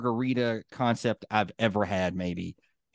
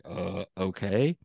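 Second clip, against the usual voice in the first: lower, slow, baffled shrug; meek okay; 4 semitones lower, 4 dB quieter, slower than usual. Retold or imitated. Imitating the two dudes answering the neighbour, sheepish and slow. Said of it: "And they're like".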